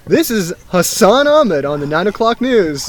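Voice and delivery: slow, lightly mock newsreader delivery; news anchor. Host jokily introducing his guest, news anchor.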